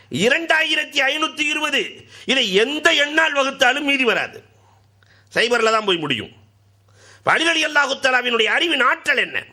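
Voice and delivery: high, ringing sermon voice; fiery preaching. Acted. Preacher exhorting the beloved listeners, high and ringing. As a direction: preach it out to the crowd.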